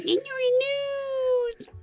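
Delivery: very high, very slow mocking whine; annoying voice impression. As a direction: stretch it out to annoy. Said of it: "your annoying voice saying".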